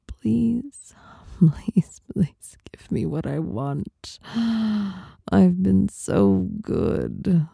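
pleading in breathy, broken phrases; breathy begging. Acted. Acting out how the listener should beg, breathy pleading. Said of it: "Not in a needy way, but in a".

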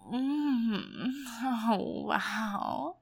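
loud breathy gasp of delight; excited moan. Acted. Excited moans at climax.

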